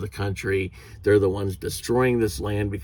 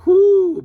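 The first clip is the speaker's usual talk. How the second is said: loud high whoop; 10 dB louder than usual. Real, not acted. Ranting about a rude manager, a loud exasperated whoop.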